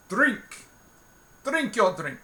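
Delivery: burly barman snapping at the guest; gruff bartender order. Acted. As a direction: push the drink on him bluntly. Bartender slams down pint, orders.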